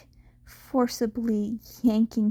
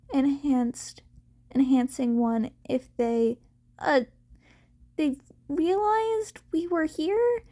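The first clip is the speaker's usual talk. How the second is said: put-on higher, hesitant rambling young voice; anxious boy rushing his reasons; nervous Izuku; 5 semitones higher than usual. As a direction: stammer through the worry, eager to explain.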